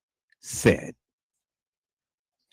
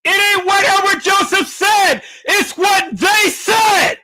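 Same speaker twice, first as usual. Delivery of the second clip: loud high shout; slow, booming declaration; 4 dB louder than usual. Acted. Dramatic shouted intro slogan.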